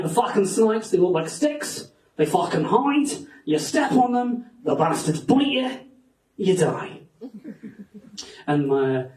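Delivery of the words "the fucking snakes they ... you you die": Australian cabbie warning a tourist; Australian accent. Acted. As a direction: warn them in broad, mock Australian. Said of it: "This is an Australian accent".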